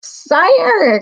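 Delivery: high piercing call; shrill. Acted. Tiny mouse calling up to the king, shrill. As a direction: pipe it up shrill from below. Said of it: "came a shrill voice".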